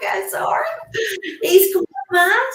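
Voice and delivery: high, loud, flustered over a barking dog; flustered apology. Real, not acted. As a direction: squeal an apology while the dog goes mad.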